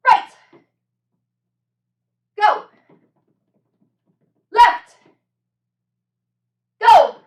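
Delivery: loud high barked commands; barking gym coach. Real, not acted. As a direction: shout each cue like a drill coach. Coach calling drill cues.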